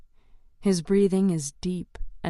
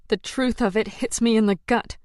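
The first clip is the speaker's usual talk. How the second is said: ordinary delivery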